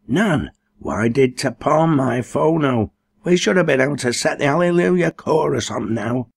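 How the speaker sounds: Cherry grumbling to Nan about the phono, querulous. A narrator's reading.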